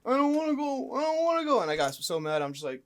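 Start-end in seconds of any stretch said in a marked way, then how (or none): 0.0-1.6 gasping high, loud whine; imitating a frightened man pleading; whimpering refusal. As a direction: plead in a raised, breathless whine. Imitating man who would not budge.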